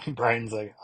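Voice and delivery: ordinary talk, nothing marked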